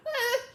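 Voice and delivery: a sharp high yelp; startled high ah. Acted. Alice startled at hurting the mouse, high yelp. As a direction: yelp as if caught out.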